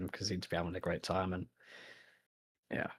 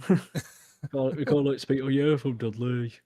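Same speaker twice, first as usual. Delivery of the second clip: exaggerated Birmingham accent, higher and louder; Brummy accent; 6 semitones higher, 8 dB louder than usual. Shown as a demonstration. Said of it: "a very Brummy accent".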